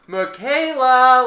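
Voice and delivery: loud drawn-out call. Retold or imitated. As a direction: call the name out long and loud. Calling out a name loudly.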